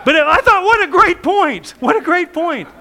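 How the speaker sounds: loud, high, laughing exclamation; gleeful shout; excited laughing shout. Real, not acted. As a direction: burst out with delight at the idea. Pastor exclaims over a point.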